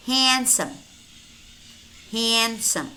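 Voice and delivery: handsome as "hansum"; silent D. Shown as a demonstration. Teacher models silent letters for learners, silent D in handsome. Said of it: "but it's silent".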